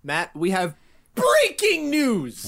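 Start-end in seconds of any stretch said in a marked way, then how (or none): 1.2-2.5 drawn-out, high announcer cry; hammed-up breaking-news announcement; mock news bulletin. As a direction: trumpet it like a huge bulletin. Mock news bulletin opening ad read.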